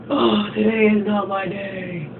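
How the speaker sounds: slow, mopey lament; imitating a gloomy guy, slow and put-on; sad sigh of a line. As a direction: drag it out like nothing goes right. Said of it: "goes back to being all sad".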